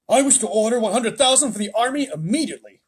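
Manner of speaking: high, loud commanding voice; army chief voice. Acted. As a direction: order it briskly.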